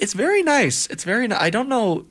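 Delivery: high, gushing praise; excited gushing. Acted. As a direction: gush over the fruit. Admiring the fruit bouquet.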